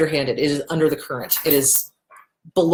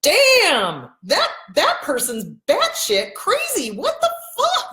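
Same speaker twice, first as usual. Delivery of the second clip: high, drawn-out disbelief, slowing down; mock-stunned what-the-hell; incredulous outburst; 11 semitones higher, slower than usual. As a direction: sound floored by how crazy they are. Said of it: "as opposed to going".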